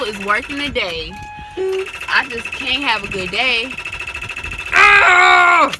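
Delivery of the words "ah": short loud cry of frustration; a sharp, fed-up scream; frustrated shout. Real, not acted. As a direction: yell out in real exasperation. Car won't start, frustration peaks.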